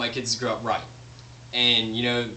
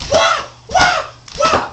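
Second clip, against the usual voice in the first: very high, much louder shrieking battle cries; mock whipping yells; 9 dB louder, much slower than usual. Shown as a demonstration. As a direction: shriek with each lash of the whip. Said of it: "grab a whip and go".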